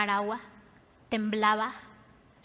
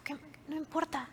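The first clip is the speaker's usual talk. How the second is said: much softer, higher acted mutter; muttered indignation; 4 semitones higher, 9 dB quieter than usual. Man who could have taken offence at Jesus, soft mock mutter. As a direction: mutter it as a small aside. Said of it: "en vez de que se indignara así de".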